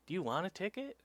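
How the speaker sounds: threatening cop. Retold or imitated. Cop threatening a ticket, acted.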